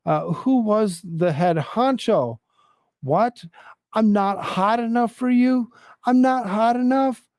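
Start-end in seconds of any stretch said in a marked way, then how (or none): 3.0-7.2 high, indignant mock scream; hammed-up retold screaming. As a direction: play the offended lady, pitched up. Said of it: "screams the lady".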